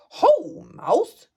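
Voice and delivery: high, bright-spirited hare greeting; cheerful hare. Acted. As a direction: call out like a bouncy hare. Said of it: "says Hare".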